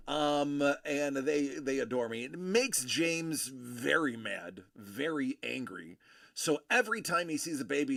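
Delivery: ordinary talk, nothing marked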